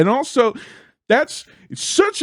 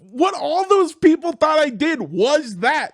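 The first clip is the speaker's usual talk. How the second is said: very high, squealing lament; embarrassed wail; 12 semitones higher than usual. Retold or imitated. Comic realizing how his joke looked, mortified.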